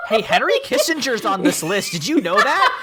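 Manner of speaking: high, laughing put-on voice; mock Mon Mothma. Mon warning her husband about the guests, high and laughing. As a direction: giggle through a high alarmed aside.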